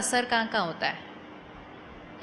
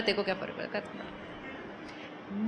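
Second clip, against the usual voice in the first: softer, slower shrug-off in a lower pitch; dismissive aside; 4 semitones lower, 10 dB quieter than usual. Acted. Colleagues consoling someone at the coffee machine, quiet dismissive aside. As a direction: toss it away quietly, letting it fade. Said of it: "कहते हैं".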